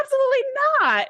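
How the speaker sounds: high, fast, flustered "absolutely not"; mock-indignant Troi. Co-host quoting Troi's flustered denial to Data, high and fast. Troi firmly refuses Data's question.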